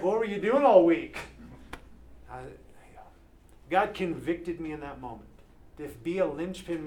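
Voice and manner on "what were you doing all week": exasperated wife. Retold or imitated. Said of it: "She's like".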